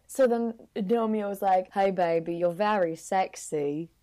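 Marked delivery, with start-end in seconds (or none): 1.7-3.9 badly faked British seducer; British heartthrob. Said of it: "That sounded like [name]".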